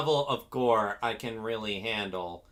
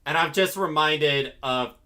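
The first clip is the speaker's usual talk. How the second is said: ordinary talk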